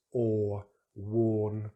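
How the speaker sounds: or and worn with rounded lips; model or. Shown as a demonstration. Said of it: "rounding the lips".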